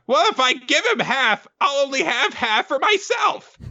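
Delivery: very high, indignant cartoon voice; Nobuteru. Leader argues with his hermit crabs, high and indignant. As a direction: protest as a squeaky little creature. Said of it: "Nobuteru is happily going".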